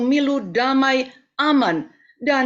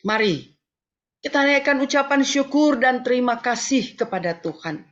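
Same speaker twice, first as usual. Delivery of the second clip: normal talking voice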